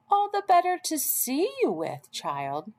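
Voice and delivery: high, quick mock-granny voice; the wolf faking a kindly old lady; wolf as Grandma. Wolf in Grandma's bed answering Red, a high fake-granny voice.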